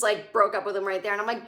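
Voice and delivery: ordinary delivery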